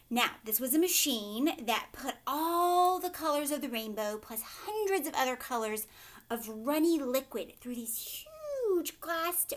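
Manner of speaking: ordinary delivery, nothing marked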